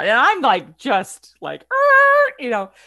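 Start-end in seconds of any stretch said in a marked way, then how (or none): 1.6-2.3 long high loud strangled er; a frozen, cringing er; stunned er. Retold or imitated. Narrator reacting to being refused at the door with a stunned er.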